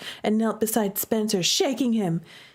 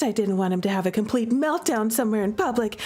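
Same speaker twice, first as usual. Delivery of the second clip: ordinary delivery, nothing marked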